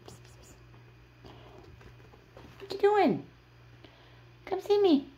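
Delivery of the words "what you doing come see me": sing-song high calls to the pets; high pet talk. Real, not acted. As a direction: coax the cats over in a high, bright pet voice. Calling the pets over.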